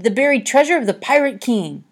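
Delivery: high, loud thrilled cry; exclaimed. A narrator's reading. Harvey raving about the pirate king, loud excited cry. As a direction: shout it like a giddy pirate. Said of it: "he exclaimed".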